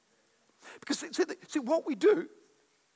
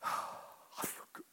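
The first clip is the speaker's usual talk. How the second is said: whispered, slow sigh of relief; breathy fake relief; mock relief; 14 dB quieter, much slower than usual. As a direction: sigh it out as if unburdened. Breathy demo of venting.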